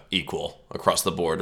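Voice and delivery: ordinary talk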